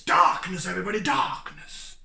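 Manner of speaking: a hint of [name], shouted out; [name] impression. Beer vlogger quoting Chappelle's [name], mock shout. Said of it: "Dave Chappelle doing [name]".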